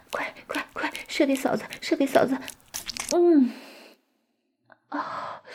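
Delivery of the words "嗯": loud high moan. Acted. Climax moan in roleplay.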